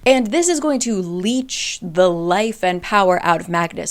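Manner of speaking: ordinary talk, nothing marked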